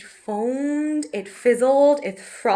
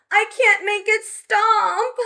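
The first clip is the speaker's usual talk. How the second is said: cried out; 8 semitones higher, 4 dB louder than usual. Acted. Betty calling to Mum coming back from the shop, alarmed. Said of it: "cried Betty".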